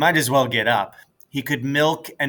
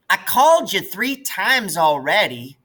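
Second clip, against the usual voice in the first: high, loud prissy big-sister voice; prissy imitation; 8 semitones higher, 4 dB louder than usual. Maybelle imitating Ellie's prissiest manner. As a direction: mimic a prissy older sister. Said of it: "imitating Ellie's prissiest manner".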